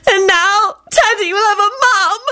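high-pitched tearful wail; happy bawling. A narrator's reading. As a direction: bawl it out, overcome with joy. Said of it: "Rosie bawled out".

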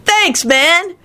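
high-pitched, beaming thanks; delighted. A narrator's reading. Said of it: "Kenny beamed with delight".

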